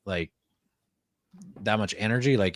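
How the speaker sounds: ordinary talk, nothing marked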